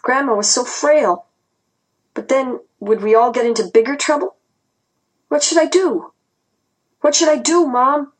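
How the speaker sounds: high-pitched, anxious inner questioning; frantic dilemma. A narrator's reading. Child silently asking Mom what to do, frantic. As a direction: plead inwardly, pitch climbing with worry.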